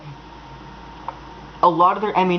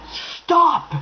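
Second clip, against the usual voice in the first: much higher, fed-up shout of stop; exasperated cry; 10 semitones higher than usual. Real, not acted. Ranting at contradicting fans, exasperated cry of stop. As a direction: cut them off in exasperation.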